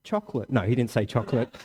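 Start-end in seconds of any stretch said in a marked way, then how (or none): 0.0-0.5 mock child answer. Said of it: "You know what he said?".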